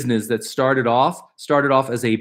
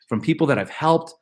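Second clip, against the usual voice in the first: ordinary talk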